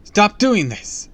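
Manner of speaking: loud, high desperate plea; frantic protest at full voice; screamed demand. A narrator's reading. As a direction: shout at him to release the children. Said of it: "I screamed".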